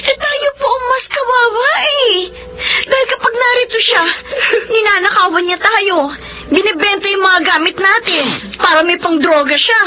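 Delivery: tearful complaint. Acted. Daughter tells mother she is tired of her brother, tearful. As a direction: let the frustration spill out in tears.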